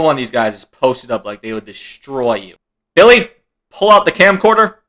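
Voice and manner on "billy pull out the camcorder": high, raised imitation of a clan boss; mock call-out. Imitated fighter telling Billy to get the camcorder, mock call-out. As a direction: call out a mock order, pitched up. Acting out clan leader ordering a recording.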